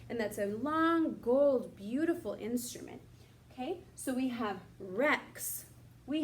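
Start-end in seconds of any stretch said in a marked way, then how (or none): none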